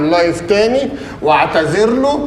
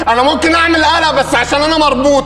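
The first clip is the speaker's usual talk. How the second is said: worked-up threat; 8 semitones higher than usual. Acted. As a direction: blow up in a hammed-up rage. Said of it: "انا معلش انفعلت شوية بس". (تهديد منفعل بصوت أعلى وأقوى)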